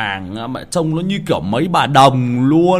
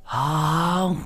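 usual speaking voice

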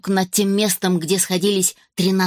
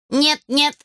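higher stern robot warning; 7 semitones higher than usual. A narrator's reading. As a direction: stop him sternly at once. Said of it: "строгим тоном начал".

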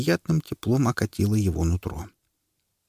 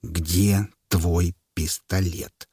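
slow, syllable by syllable; much slower than usual. Acted. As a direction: spell out every syllable threateningly. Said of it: "глухо, почти по слогам повторил". (муж глухо, по слогам повторяет вопрос)

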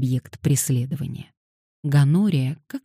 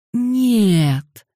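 groaned; 8 semitones higher, 6 dB louder than usual. Acted. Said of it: "простонал Дэниел".

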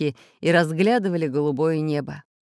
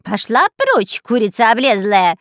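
loud, raised, scornful yell; angry shooing; 3 semitones higher, 7 dB louder than usual. A narrator's reading. Spy waving off the enemy parrot, angry. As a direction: shoo it away loudly.

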